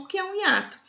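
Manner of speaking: normal talking voice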